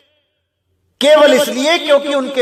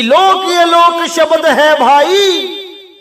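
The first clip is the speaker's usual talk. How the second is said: slow, higher-pitched scripture verse; verse recitation; 5 semitones higher, much slower than usual. Acted. Preacher quoting what Sahib hinted, slow raised recitation. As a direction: stretch the verse out, raised and sustained.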